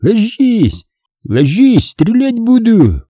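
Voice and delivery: high-pitched warning shout; urgent high holler; yelled threat. A narrator's reading. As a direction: order him down, calling from afar. Pursuers yelling orders at fugitive.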